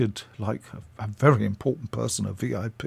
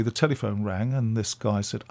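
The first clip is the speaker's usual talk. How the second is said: ordinary delivery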